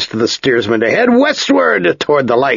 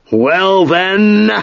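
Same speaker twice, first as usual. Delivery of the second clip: higher, clipped call over the wind; bellowing master; 8 semitones higher than usual. A narrator's reading. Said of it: "the master bellowed".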